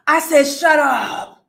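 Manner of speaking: loud, emphatic "shut up"; loud disbelief. Retold or imitated. Commentator repeating her reaction to the AI rumor, loud disbelief. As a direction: belt out the disbelief.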